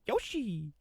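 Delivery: cartoon Yoshi voice, quiet and high; Yoshi imitation. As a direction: chirp the name like the little dinosaur. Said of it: "where Yoshi went".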